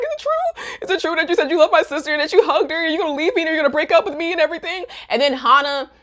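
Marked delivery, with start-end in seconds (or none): 0.0-4.8 high, very fast frantic questioning; hammed-up breathless barrage; mock hysterics. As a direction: imitate a panicking jealous girlfriend. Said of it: "Hana is just in hysterics now".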